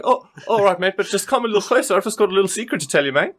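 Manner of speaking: high-pitched, hurried put-on Australian accent. Captive luring the wizard closer with a secret, mock Australian accent. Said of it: "a little bit of an Australian accent".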